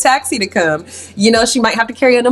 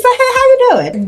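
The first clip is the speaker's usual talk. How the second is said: put-on high girly greeting; 3 dB louder than usual. Jane greeting the fella on the plane, high sing-song. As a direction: pitch way up, sing-song hey. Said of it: "She said".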